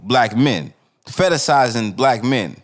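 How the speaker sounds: ordinary talk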